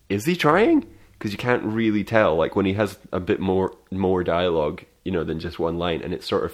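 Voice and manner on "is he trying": high, incredulous "is he trying?"; puzzled inner question. Retold or imitated. Viewer doubting the actor's accent, incredulous and high. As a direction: ask it in disbelief, pitched way up. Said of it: "and you're like".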